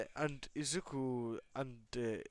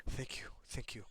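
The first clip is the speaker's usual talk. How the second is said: soft breathy whispered thank you; 8 dB quieter than usual. A narrator's reading. Rescued boy whispering thanks to his healer. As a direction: barely voice it, grateful and drained. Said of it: "As Dabi says".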